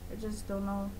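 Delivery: usual speaking voice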